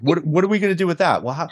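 usual speaking voice

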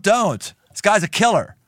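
normal talking voice

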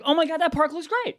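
a dog thrilled by a TV park; excited dog voice. Retold or imitated. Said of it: "was like".

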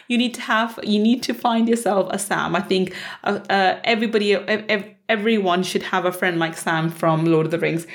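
ordinary talk

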